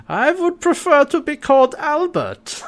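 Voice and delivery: Harvey. Retold or imitated. Harvey posing as Einstein to John, bad Austrian German accent. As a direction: put on a hammed-up German professor accent. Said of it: "like, Austrian German accent".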